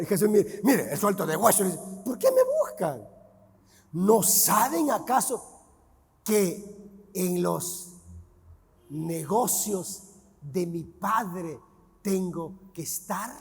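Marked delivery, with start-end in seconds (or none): 2.0-13.3 put-on a boy calmly explaining to his parents; soft, slow, deliberate answer; calm reply.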